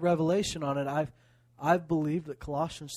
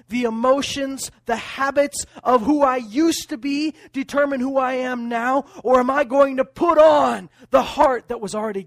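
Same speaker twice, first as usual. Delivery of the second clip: loud, rising fiery preaching; preaching at full force; 7 semitones higher, 11 dB louder than usual. Acted. Preacher challenging believers about daily choices, fiery and loud. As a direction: drive it home to the pews.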